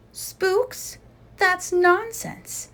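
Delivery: high, slow incredulous scoff; scoffing disbelief. Acted. Leader Jane scoffing at the Scouts' spook story. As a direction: scoff at the silly idea.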